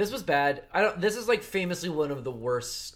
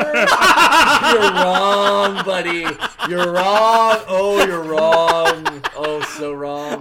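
taunting; 4 semitones higher, 11 dB louder, much slower than usual. Pretend. Playful taunting prediction.

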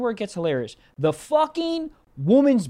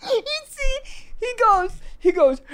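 laughing; 13 semitones higher, slower than usual. Real, not acted. Teller laughing at crying boyfriend.

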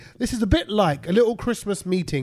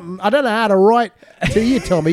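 mock Aussie accent; 2 semitones higher, 3 dB louder than usual. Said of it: "Or are you ballsing this up".

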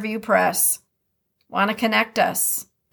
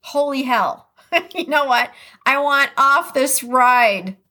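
mock exasperation; 5 semitones higher, 3 dB louder than usual. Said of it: "you're feeling like".

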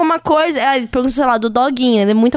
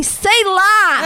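higher, mock dismissive outburst; drunk careless reply; 5 semitones higher than usual. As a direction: toss it off like a tipsy partygoer. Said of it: "eu falei assim".

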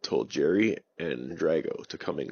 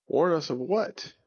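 surprised question; 8 semitones higher than usual. Acted.

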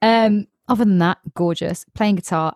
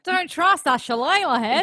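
higher-pitched, hammed-up Australian accent; mock Australian accent; 8 semitones higher than usual. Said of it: "suddenly become Australian".

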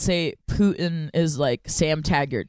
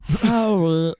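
throaty, louder, drawn-out attempt; Severus Snape; 5 dB louder than usual. Shown as a demonstration. Second try at the impression, throaty loud drawl. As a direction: push it out from the throat, loudly. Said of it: "This is a throatier impression".

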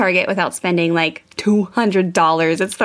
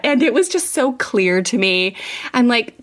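ordinary talk

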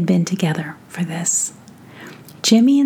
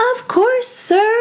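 very high, squeaky chorus; little fairies cheering; tiny fairy voices. Acted. Fairies answer together.

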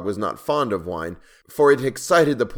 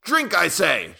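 high, raised, forceful order; shouted command; 9 semitones higher, 4 dB louder, slower than usual. A narrator's reading. Enraged king ordering the dwarf to drink, shouting. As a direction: order him in a near shout. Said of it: "shouted the monster".

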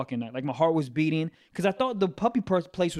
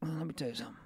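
much softer, cornered reply; sheepish; 15 dB quieter, much faster than usual. Real, not acted. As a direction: shrink back and say it under your breath.

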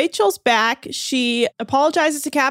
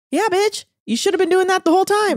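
high, fast mock snap back; a sarcastic clapback in a raised voice; sassy retort; 8 semitones higher, faster than usual. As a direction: tell her off with attitude. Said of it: "And I'm like".

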